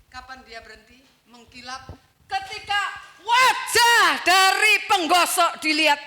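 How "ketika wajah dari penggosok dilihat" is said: loud, slow preaching peak; preaching at full force. Acted. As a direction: proclaim it to the church. Preaching climax. (khotbah lantang)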